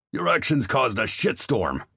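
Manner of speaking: high, hard angry growl of a commander. Acted. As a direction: snarl it at the private, furious. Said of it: "the commander growled".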